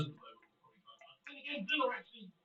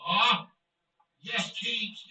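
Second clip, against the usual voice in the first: loud, quick cheer of Yes, Keats; excited yes; 2 semitones higher, 8 dB louder, much slower than usual. Real, not acted. Streamer cheering his scorer, excited celebration. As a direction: punch out Yes, Keats at full voice.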